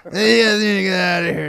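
high mock drunk yelling; drunk woman shouting. Guy mimicking the drunk chick kicking them out, mock shout. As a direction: imitate a drunk woman throwing people out. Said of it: "she's drunk and all this shit".

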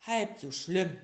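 loud, cheerful little bear; hearty bear. Acted. Bear comforts the sick tiger, loud and cheerful. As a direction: brush it off, big and hearty. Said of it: "sagte der kleine Bär".

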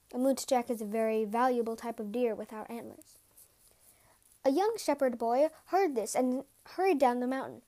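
usual speaking voice

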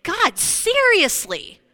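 high, loud, drawn-out cry of disbelief; loud and incredulous; exasperated cry. Retold or imitated. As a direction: let the frustration burst out at God. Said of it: "And I just went".

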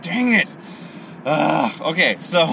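high, loud comic exasperation; mock frustration. Gideon finding the sign fulfilled, comically frustrated. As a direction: play Gideon's dismay for laughs.

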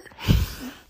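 short breathy laugh. Real, not acted. Podcaster amused by their own fake accent, laughing. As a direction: crack up briefly at your own silly voice.

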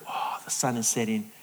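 soft, low, near-whispered bliss; hushed savouring. Acted. Parent savouring a sunset moment, hushed contentment. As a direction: sigh it quietly, soaking in the moment.

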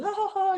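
high-pitched imitated laugh; mocking bullies' laugh. Bullies laughing at a child they shoot, mock laughter. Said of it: "dibully tuh".

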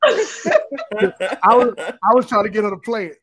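laughing apology. Real, not acted. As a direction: giggle out an excuse. Laughing after being teased.

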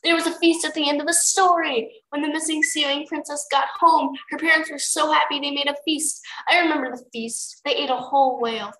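put-on high-pitched childlike gushing, easing slightly; eager excitement. A narrator's reading. Clay remembers the story's feast, eagerly excited. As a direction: gush about it in character. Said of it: "Clay cried".

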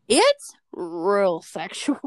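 very slow, stretched-out words; milk every word, dragging it right out; exaggerated drawn-out echo. Real, not acted. Playfully echoes the other host's words.

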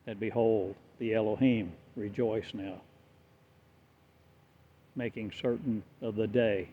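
normal talking voice

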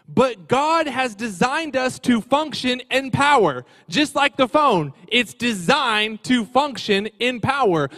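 loud, slow preacher's drive; full-force preaching. Acted. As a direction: hammer the point home to the congregation.

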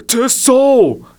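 high, loud terrified gasp; shuddering gasp. Acted. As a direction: gasp it out in fright. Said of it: "gasped the fat man with a shudder".

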